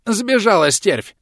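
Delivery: high, slow, agitated outburst; blurted out. Acted. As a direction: blurt it out in alarm. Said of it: "выпалил он". (выпалил в волнении)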